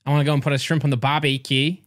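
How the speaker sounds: hammed-up Aussie greeting; comic Australian impression at normal pitch; Australian accent. Shown as a demonstration. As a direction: put on a broad Australian accent. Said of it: "What about Australian?".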